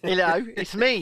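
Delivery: put-on South African greeting; Elon Musk. Elon introducing himself to the hosts, South African accent impression. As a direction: announce yourself in a mock South African accent. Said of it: "That was Elon".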